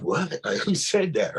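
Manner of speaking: high, alarmed questions; startled. Retold or imitated. Startled by a whisper, he asks who spoke, high. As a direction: jump at a voice out of nowhere.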